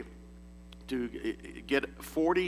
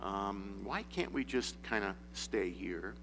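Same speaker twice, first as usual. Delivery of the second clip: softer, lazy comfortable voice; mock complacent; 5 semitones lower, 9 dB quieter than usual. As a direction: sound happy not to move. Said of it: "the natural inclination is that".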